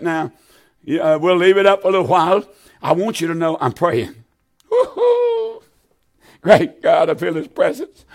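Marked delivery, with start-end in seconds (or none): 4.7-5.6 high falsetto woo-hoo of praise; joyful whoop. Real, not acted. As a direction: let out a happy holler. Preacher whoops in fervor.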